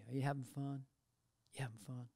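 soft, low, tired question; quiet old-timer asking if you're having fun; softly murmured question; low energy. Retold or imitated. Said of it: "low energy".

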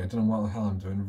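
usual speaking voice

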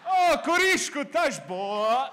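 shrieking high, loud mock Portuguese; hiccupy Portuguese. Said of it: "It sounds like a hiccup".

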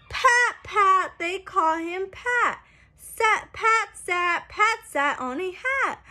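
bright, sing-song picture-book reading; reading to children. A narrator's reading. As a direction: read it out like a storybook for little kids. Book text read aloud.

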